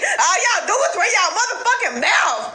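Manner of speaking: high, laughing yell; calling out the crowd, shouting; exasperated shout. Real, not acted. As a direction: demand an answer, shouting. Rant calling people to act.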